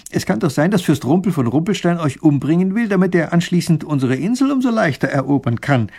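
lightly voiced worrier pressing a warning; wary, unconvinced adviser. Voices the distrustful Raffini.

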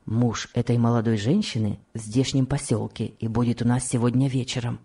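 low man's voice; confiding husband. Acted. Husband tells wife of the coming reunion, low. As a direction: drop the pitch well down to confide.

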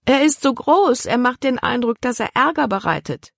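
put-on girl's alarmed protest. A narrator's reading. Libby protests about the dog.

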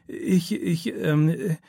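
high-pitched, hesitant stammer. A narrator's reading. Startled pupil caught by the teacher, stammering. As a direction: stumble over your first word, caught out. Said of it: "stammelt er".

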